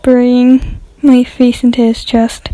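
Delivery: ordinary delivery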